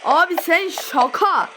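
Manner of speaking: yavaş ve yüksek sesle, isyan eder gibi; bıkkın sitem. Real, not acted. Exasperated at game. (oyuna sinirlenip yavaşça isyan)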